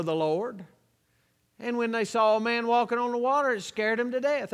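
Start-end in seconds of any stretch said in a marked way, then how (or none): none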